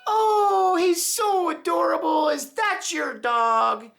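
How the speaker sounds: put-on very high, slow, sweet elderly lady voice; old lady voice. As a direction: coo like a delighted old woman. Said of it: "And she'd say".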